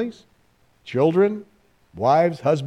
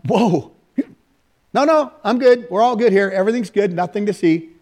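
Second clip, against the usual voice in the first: nervous cover-up; 5 semitones higher than usual. Retold or imitated. Caught cussing, assuring the guys he's fine, flustered. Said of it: "And they're like".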